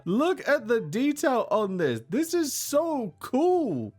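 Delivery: high slow awe; "so cool" drawn out high and slow; awed. Real, not acted. Awed exclamation.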